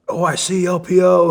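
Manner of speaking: high, loud shouted call. Retold or imitated. Instructors summoning class leaders, calling out loudly. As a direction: raise it like a roll-call yell.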